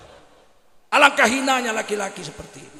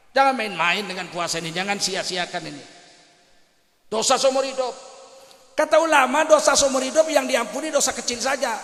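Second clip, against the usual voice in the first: normal talking voice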